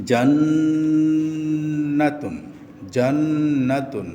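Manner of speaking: জান্নাতুন drawn out very slowly, humming the doubled ন; nasal hold demo. Shown as a demonstration. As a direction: sing out the long nasal ন in জান্নাতুন. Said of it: "নুন ও মিমে তাসদিদ হলে গুণনা করে পড়তে হয়".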